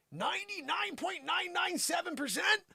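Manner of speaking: shrill hammed-up disbelief; mock disbelief. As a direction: say the number as if it can't be true. Incredulous at the statistic.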